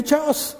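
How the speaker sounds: "chaos" said with a ch as in chair; mispronounced chaos. Shown as a demonstration. Trainer showing how people get it wrong, "chaos" with a ch as in chair. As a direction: say "chaos" wrongly, starting "ch" like church. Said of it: "It's not chaos. It's chaos".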